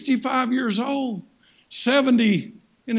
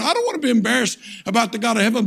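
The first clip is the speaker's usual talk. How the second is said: ordinary talk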